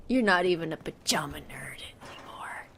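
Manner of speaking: soft, low whispered taunt; muttered secret insult. Real, not acted. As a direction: hiss it under your breath.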